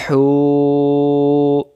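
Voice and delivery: হু held out for one alif count; one alif stretch. Shown as a demonstration. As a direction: draw out হু, chanted. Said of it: "এক আলিফ টানতে হয়".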